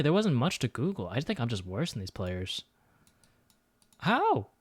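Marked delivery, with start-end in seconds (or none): 4.0-4.5 high incredulous cry; can't believe it; shocked how. Real, not acted. Shocked at others' guess.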